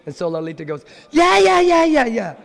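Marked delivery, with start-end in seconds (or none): 1.1-2.4 very high, loud squeaky chorus of yeah; squealing friends urging Radharani on; pushy girlfriends. Acted. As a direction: egg her on like giddy teens. Lalita urges her again.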